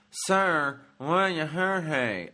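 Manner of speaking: thick Southern hillbilly drawl; hillbilly accent. Retold or imitated. Cashier asking a stranger's birthday, Southern hillbilly accent. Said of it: "she was speaking hillbilly-ese".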